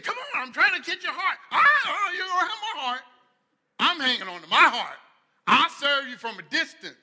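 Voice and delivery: hammed-up pleading chicken dialogue; lightly voiced pleading chicken; acted chicken reply. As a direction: play both sides, loud and high.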